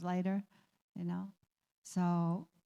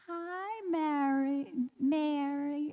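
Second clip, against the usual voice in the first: lightly voiced old lady, higher pitched; a hint of a sweet elderly woman greeting, higher; imitating Bea; 6 semitones higher, much slower than usual. Caregiver imitates elderly Bea greeting her, lightly voiced and higher. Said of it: "how the way she said it".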